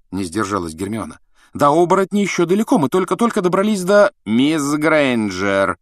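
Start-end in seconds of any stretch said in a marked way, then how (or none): 1.5-4.1 put-on high, fast protesting girl; eager schoolgirl. A narrator's reading. Hermione protests. 4.2-5.8 very slow, icy cutting rebuke. A narrator's reading. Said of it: "ледяным голосом осадил".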